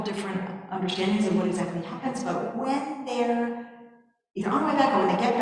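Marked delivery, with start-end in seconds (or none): none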